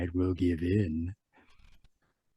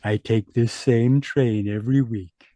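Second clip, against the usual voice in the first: normal talking voice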